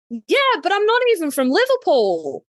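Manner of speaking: high, hammed-up objection; indignant protest. Teased fan objecting to the accent joke, indignant mock protest.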